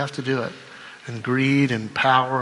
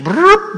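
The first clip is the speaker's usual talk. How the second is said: engine noise; 7 dB louder than usual. Retold or imitated. Imitating truck starting.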